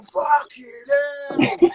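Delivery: high, loud yelp of a curse; retold startled shout. Telling how he got a scare by the van, startled shout. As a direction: yell it like you just got the fright.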